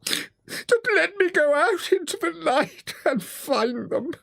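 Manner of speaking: high, drawn-out tearful plea; wailing despair. A narrator's reading. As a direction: plead in a very high, slow wail. Toad begs in despair.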